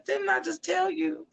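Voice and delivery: high-pitched, restrained warning; pestered lion. Acted. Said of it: "the lion looks over and says".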